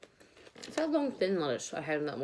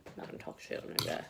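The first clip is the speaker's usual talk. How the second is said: whispered aside; 8 dB quieter than usual. Real, not acted. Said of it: "Caught myself".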